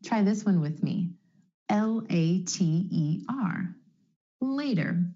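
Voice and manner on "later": "Later" with the T flapped like a quick D. Shown as a demonstration. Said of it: "The flap T is really just a D sound".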